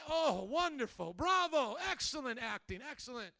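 high, loud gushing bravos; acted admiring applause. Audience standing to praise the orator's acting, mock gushing cheers. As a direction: cheer a performer with exaggerated delight. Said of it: "start to stand to their feet going".

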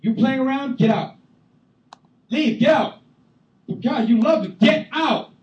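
high, loud ordering out; hammed-up furious eviction; mock angry God. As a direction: throw them out loudly. Mock God kicking people out.

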